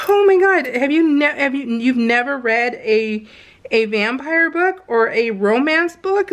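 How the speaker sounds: usual speaking voice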